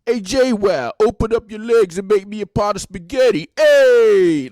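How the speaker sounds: loud, high Jersey wise guy voice; shouted mock wise guy; wise guy impression. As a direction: ham up a Jersey Shore tough guy calling out. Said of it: "just straight wise guy".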